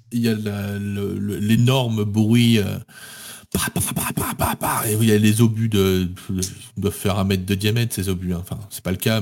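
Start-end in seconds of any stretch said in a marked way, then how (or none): 3.5-4.9 gunfire sound effect. Retold or imitated. Imitating turret gunfire.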